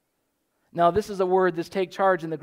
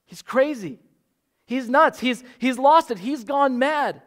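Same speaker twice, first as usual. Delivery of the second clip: higher, louder, alarmed insistence; alarmed family; 6 semitones higher, 4 dB louder than usual. Retold or imitated. Jesus' family insisting he has lost his mind, alarmed. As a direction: press the point like worried kin. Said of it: "They said".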